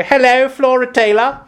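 put-on very high, posh telephone voice with royal English accent; grandmother's high, regal English phone voice; Queen Elizabeth. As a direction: answer the phone in put-on upper-class English. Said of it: "it sounded like Queen Elizabeth".

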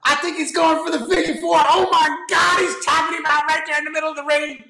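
high, excited ringside play-by-play; wrestling commentator. Pretend. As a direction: call it like a frantic wrestling announcer. Mock wrestling match call.

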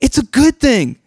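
high, upbeat, cheerful retelling of Jesus; happy reassurance. Retold or imitated. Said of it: "He's like".

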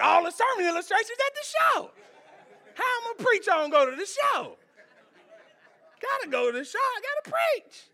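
high, fast whiny self-impression; mock whiny preacher. Preacher mocking his own complaint, high and whiny. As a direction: whine like a preacher short of illustrations.

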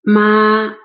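ma high, loud and level; flat first tone. Shown as a demonstration. Teacher reviewing the first of three tones, flat. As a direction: hold ma long on one high pitch. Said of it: "Let's review the first".

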